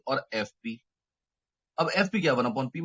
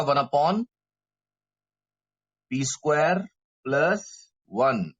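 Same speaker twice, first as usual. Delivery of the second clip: slow dictation with pauses, louder on key terms; slow, for repeating; 3 semitones higher, 5 dB louder, slower than usual. Real, not acted. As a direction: speak slowly so students can say it along. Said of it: "मैं धीरे-धीरे इसलिए बोल रहा हूं". (धीरे-धीरे, रुक-रुक कर बोलते हुए)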